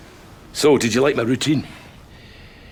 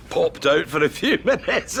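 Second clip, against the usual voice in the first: repeating the line through laughter; laughing; 9 semitones higher than usual. Real, not acted. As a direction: chuckle through your own punchline. Repeats own joke, laughing.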